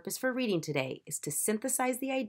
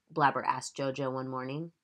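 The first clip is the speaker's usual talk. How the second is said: ordinary talk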